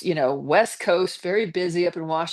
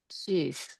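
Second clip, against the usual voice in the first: ordinary delivery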